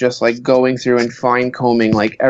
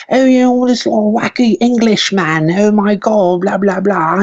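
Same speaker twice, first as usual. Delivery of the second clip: mock a wacky Englishman, much higher; Russell Brand impression; 8 semitones higher than usual. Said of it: "kinda sounded like Russell Brand".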